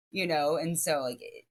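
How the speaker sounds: usual speaking voice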